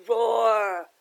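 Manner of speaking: big roaring lion; loud storybook lion roar. Acted.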